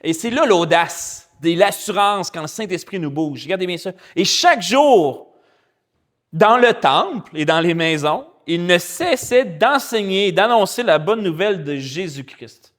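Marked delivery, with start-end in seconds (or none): 4.2-12.7 slow, louder, solemn reading of the verse; scripture reading. Acted. Preacher reading verse forty-two to the church, slow and solemn. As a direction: read each phrase weightily to the congregation.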